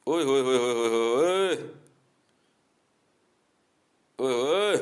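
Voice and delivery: loud, high, slow mocking "oye hoye"; jeering "oye hoye". To the Sikh men, a loud high taunting "oye hoye". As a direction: drag out a taunting singsong jeer.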